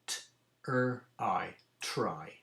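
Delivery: T-er-I, then blended try; sounding out try. Shown as a demonstration. Teacher to class, sounding out try. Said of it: "sounding out word".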